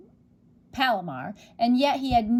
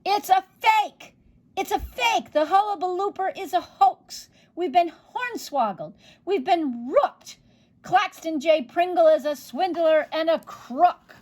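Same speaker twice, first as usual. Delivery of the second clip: high, loud outraged shouts; fooled crowd shouting at the showman; 7 semitones higher, 4 dB louder than usual. Acted. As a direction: yell it as a cheated mob. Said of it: "burst into uproar and shouting".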